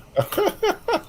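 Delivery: very high, soft staccato giggle. Real, not acted. Laughing at the elephant joke's punchline, high soft giggle. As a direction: let out a small high-pitched giggle.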